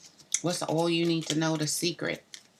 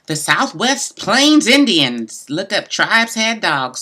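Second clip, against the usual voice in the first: reading the card aloud; 2 semitones higher, 9 dB louder, slower than usual. Acted. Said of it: "The dog says".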